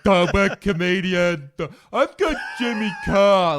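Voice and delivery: loud, high, drawn-out blurting; hammed-up shout. Comedian explains himself to the customs lady, hammed-up shouting. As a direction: call it out slowly like broken English. Acting out answer at customs stop.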